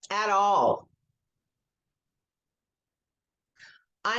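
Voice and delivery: slow guided script with long pauses; guided tapping script. Acted. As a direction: lead the group gently, line by line.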